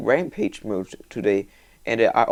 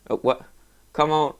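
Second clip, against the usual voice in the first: ordinary delivery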